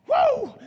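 loud high yelp of slipping; startled whoo. Retold or imitated. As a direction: yelp as your feet go out from under you. Retelling his fall in the shower.

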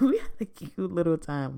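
giggling through the words; giddy giggle recalling a date; laughing. Real, not acted. Laughs while telling.

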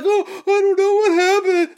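high, playful fake innocence; high innocent kid voice. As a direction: play dumb like a kid. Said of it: "They're gonna be like".

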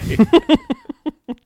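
loud high-pitched cackle. Acted. Laugh after acted quotes.

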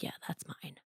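breathy whisper, almost silent; whispered thought. Retold or imitated. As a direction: whisper it to yourself like a private boast. And I was like.

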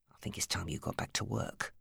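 low unvoiced hiss. A narrator's reading. Steve threatening Alice, unvoiced hiss. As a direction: hiss the threat under breath. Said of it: "he hissed".

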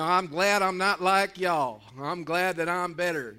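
slow, loud self-satisfied boast; loud proclamation. Retold or imitated. Preacher voices the Pharisee exalting himself above others, loudly proclaiming. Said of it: "loudly proclaiming".

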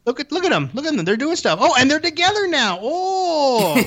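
high-pitched mock wonder; gushing fake awe, ending on a long "oh"; mock studio pitch. DC distracting fans from weak story, high mock awe. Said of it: "they're trying to say, like".

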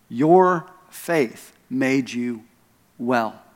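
very slow, "Your" and "faith" set apart; word by word, weight on "Your faith", "well" falling low; stress on "Your faith". Retold or imitated. Preacher on what made her well, slow stress on "Your faith". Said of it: "this puts the emphasis not on God's".

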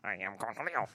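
lightly voiced cartoon alter ego; a hint of the manic cartoon character; Cornholio voice. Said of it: "Ghost of Cornholio".

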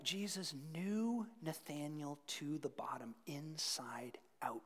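intimate whisper, slowing down. Real, not acted. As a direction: draw the room in with a hush. Preacher drops to whisper.

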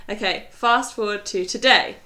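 ordinary talk, nothing marked

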